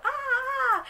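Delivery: very high mock shriek from the fire; witch scream. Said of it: "You hear her go".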